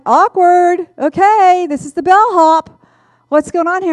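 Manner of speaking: high, loud startled reaction; awkward surprise. Retold or imitated. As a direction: react like it's suddenly very awkward. Inner reaction to bellhop hug.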